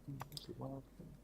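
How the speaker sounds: muffled, distant mic sound. Real, not acted. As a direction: voice through a bad, muffled mic. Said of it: "it sounds like your mic's muffled".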